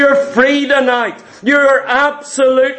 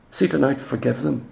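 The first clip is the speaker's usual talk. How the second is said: low, hushed plea; a quiet pastoral appeal to forgive; soft urging; 10 semitones lower, 10 dB quieter, faster than usual. Real, not acted. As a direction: press it gently and softly. Preacher urging the saints softly.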